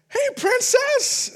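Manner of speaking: high, slow sing-song hey princess; cheery greeting. Retold or imitated. Gym manager greeting a boy, high and slow. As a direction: coo it at a little kid. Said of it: "and I'm like".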